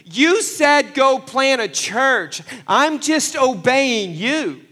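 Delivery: pleading complaint to God. Pretend. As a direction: cry out to God as if he broke a promise. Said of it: "this is where I could have been like".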